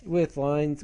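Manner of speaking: ordinary talk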